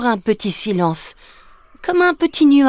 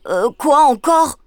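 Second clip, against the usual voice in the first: higher, hesitant little ogre; weary ogre; 6 semitones higher, 3 dB louder than usual. Acted. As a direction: ask warily what's wrong now. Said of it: "demande Bouli-Bouffe-Tout". (petit ogre inquiet et hésitant)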